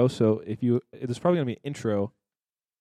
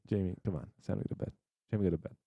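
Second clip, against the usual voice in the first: soft parent voice; 3 semitones lower, 9 dB quieter, much faster than usual. Pretend. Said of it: "a small child".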